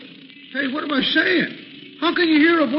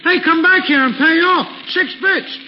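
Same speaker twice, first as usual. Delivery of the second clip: calling after; 4 semitones higher, 5 dB louder than usual. Acted. Cab driver shouts after fare.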